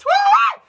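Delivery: high, loud preacher's whoop; ecstatic woo. Real, not acted. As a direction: whoop it out in fervor.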